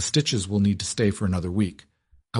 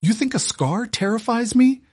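high, trembling furious outburst; fierce anger; 8 semitones higher than usual. A narrator's reading. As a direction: let the anger pour out at him. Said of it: "her voice trembling with sudden, fierce anger".

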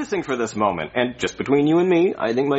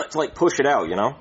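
ordinary talk, nothing marked

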